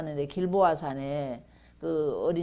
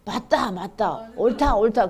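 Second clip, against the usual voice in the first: emphatic approval, building louder; repeated cries of assent, lightly acted; acted agreement; 2 semitones higher, 7 dB louder, slower than usual. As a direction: voice the poor crowd heartily agreeing. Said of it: "가난한 사람들은 어때요?".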